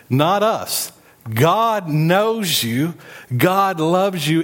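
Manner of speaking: high, slow, emphatic God knows you; exultant proclamation. Real, not acted. Preacher proclaiming to the church, exultant and high. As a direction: declare it with joy. Climax after the verse.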